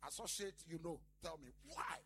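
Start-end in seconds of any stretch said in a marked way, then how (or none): none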